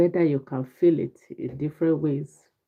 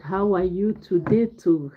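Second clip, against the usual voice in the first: ordinary talk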